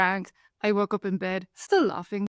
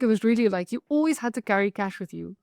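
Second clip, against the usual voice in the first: usual speaking voice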